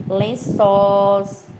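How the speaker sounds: "lençóis" drawn out on open ói; slow teacher's "lençóis" with long open ó; open diphthong. Shown as a demonstration. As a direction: stretch the last syllable of "lençóis", vowel wide open. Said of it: "eles são ditongos abertos".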